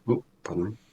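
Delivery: ordinary delivery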